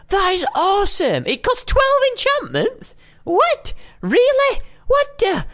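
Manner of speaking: high, disbelieving outburst; shocked. Real, not acted. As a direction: squeal in disbelief. Shocked at enchantment cost.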